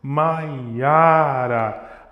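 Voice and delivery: "Maiara" intoned slowly, leaning on "ia"; weak "Ma", long strong "ia", falling "ra"; stress on middle syllable. Shown as a demonstration. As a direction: stretch "Maiara" like three bowed notes. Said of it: "a sílaba forte está no meio".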